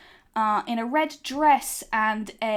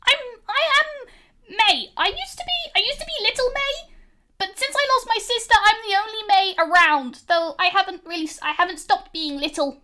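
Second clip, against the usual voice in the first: put-on much higher, small girl's voice; little May; 10 semitones higher than usual. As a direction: speak as a tiny proud girl. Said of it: "and she says".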